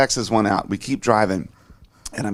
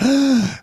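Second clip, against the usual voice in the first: mock gasp. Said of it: "I was like".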